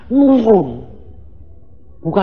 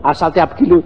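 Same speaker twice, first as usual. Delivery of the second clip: ordinary talk, nothing marked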